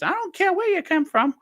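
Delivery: very high falsetto nagging mother; high falsetto mother telling off the wise men; Brian's mum voice. Retold or imitated. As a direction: scold them like a cross mum in drag. Brian's mum snapping back.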